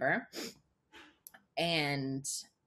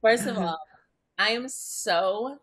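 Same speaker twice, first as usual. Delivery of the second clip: normal talking voice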